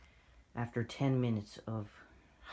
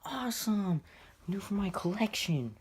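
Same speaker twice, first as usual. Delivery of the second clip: high-pitched, over-the-top hype; hyped up; 12 semitones higher, 3 dB louder than usual. Retold or imitated. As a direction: hype it up like a thrilled collector. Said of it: "of hyping".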